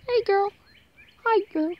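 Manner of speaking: high-pitched greeting to a puppy; pet talk. Real, not acted. Vlogger greets a favorite puppy, high pet talk. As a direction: coo a high hello at the dog.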